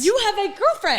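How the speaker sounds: high, loud incredulous jab; indignant outburst. Real, not acted. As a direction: fire it back, shocked. Friend chimes in indignant.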